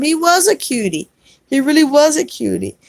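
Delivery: high, fond gushing over a cutie. Real, not acted. Gushing to the viewer who had a crush.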